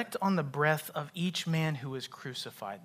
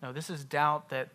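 ordinary talk, nothing marked

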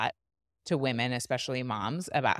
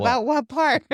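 higher, louder mock of a puzzled husband; 11 semitones higher, 9 dB louder than usual. Wife imitates husband's baffled reply, higher and louder. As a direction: play the clueless husband, squeaky and loud. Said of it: "And he's like".

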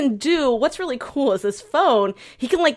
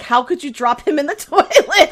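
higher, louder retold outrage breaking into laughter; mock-angry scolding; 6 semitones higher, 5 dB louder than usual. Receptionist mad about the dropped phone, laughingly imitated scolding. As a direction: scold in mock disbelief, cracking up. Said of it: "She's so mad at him".

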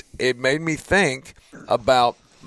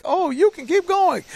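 much higher, syrupy mock greeting card line; sappy greeting card voice. Host mocks hollow Hallmark encouragement, sugary and high. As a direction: coo it like a saccharine Hallmark card. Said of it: "not getting a Hallmark card".

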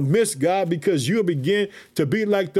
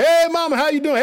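much higher, louder delighted hello; joyful greeting; 7 semitones higher, 3 dB louder, faster than usual. Pretend. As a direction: call out to Mama with pure joy. Imagined greeting to his mother in heaven.

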